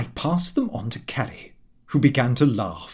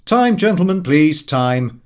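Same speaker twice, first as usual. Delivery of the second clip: loud call of closing time like a barman; mock pub shout; 3 semitones higher, 7 dB louder than usual. A narrator's reading. Frank calling time on the rowdy guests, loud shout. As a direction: bellow it to end the party. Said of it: "Frank Mutler shouted".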